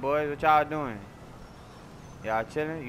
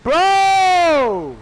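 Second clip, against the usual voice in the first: loud high shout; 14 semitones higher, 13 dB louder than usual. Real, not acted. Shouting at the game as things go wrong, loud high yell.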